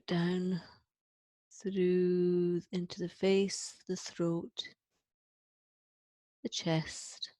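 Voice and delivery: calm meditation guide, easing down on the exhale; guided breathing meditation. Acted. Leads the exercise one more time.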